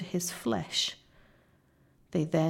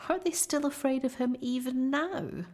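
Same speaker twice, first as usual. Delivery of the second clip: put-on tearful girl; 7 semitones higher than usual. A narrator's reading. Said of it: "sobbed Susan".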